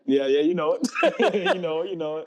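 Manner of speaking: high, loud, laughing through the words; real laughter bubbling up mid-phrase; laughing agreement. Real, not acted. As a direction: agree delightedly, breaking into laughter. Amused agreement at host's lyric quote.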